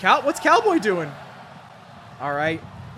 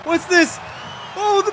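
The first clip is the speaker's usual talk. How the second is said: excited shout; 12 semitones higher than usual. Real, not acted. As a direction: yell at the big move. Finisher move starts.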